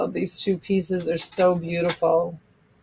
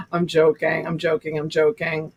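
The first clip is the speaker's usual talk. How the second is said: ordinary talk, nothing marked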